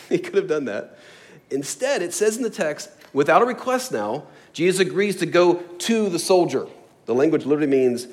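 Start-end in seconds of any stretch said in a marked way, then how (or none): none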